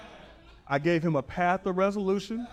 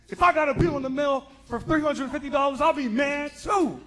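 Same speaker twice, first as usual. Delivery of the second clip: matching his energy; 10 semitones higher, 4 dB louder than usual. Retold or imitated. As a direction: pretend to be outraged alongside him. Rep empathizes, matching energy.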